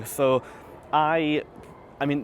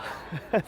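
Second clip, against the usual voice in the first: breathy laugh; 4 semitones higher, 6 dB quieter than usual. Real, not acted. Laughing at own polytunnel joke.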